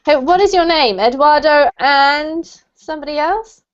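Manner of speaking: ordinary talk, nothing marked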